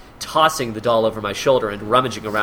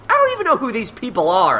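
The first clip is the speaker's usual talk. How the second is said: high, fast exasperated whine; hammed-up, hurried grievance; complaining; 10 semitones higher, 3 dB louder, faster than usual. A narrator's reading. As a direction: complain in a rushed high pitch. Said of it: "I complained".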